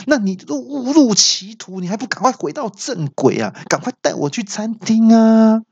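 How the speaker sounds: high-pitched furious ordering; hammed-up exasperated demand; angry yell. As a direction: shout in mock outrage, pitch way up. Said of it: "气得大叫说".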